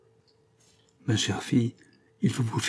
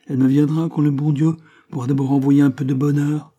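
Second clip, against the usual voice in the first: loud, raised-pitch grumbling; grumpy child; 5 semitones higher, 6 dB louder than usual. A narrator's reading. Woman makes her condition to the priest, grumpy. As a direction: protest like a sulking child. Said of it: "à la façon des enfants grognons".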